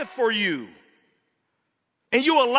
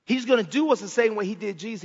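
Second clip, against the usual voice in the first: ordinary talk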